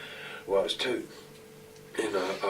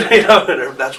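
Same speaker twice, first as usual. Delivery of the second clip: laughing; 8 semitones higher, 15 dB louder than usual. Real, not acted. Laughing over the pile-on.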